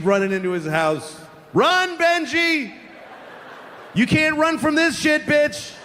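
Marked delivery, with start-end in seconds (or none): 1.5-5.7 very high, drawn-out mock yell; gleeful taunting yell. Grown comic to little Benji fleeing home, taunting yell. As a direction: holler it tauntingly after someone running away.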